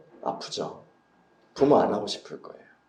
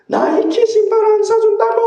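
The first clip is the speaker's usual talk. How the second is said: shrill, much higher, much louder bratty yell; whiny child tantrum; 9 dB louder than usual. Retold or imitated. Pastor mimicking a child denied Nike shoes, screeching tantrum. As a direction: scream it like a spoiled kid denied sneakers.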